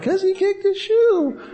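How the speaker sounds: put-on high, small child's reply; squeaky pretend child; little kid voice. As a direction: answer like a shy little kid. Voicing a little kid answering.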